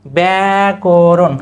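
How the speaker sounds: ব্যাকরণ drawn out slowly as bae-ko-ron; slow model pronunciation. Shown as a demonstration. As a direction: stretch ব্যাকরণ into clear syllables. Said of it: "কি হবে উচ্চারণ?".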